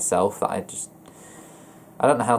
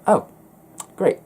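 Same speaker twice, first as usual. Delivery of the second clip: sarcastic; 11 semitones higher, 5 dB louder than usual. Retold or imitated. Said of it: "I'll read it out, and I'll be like".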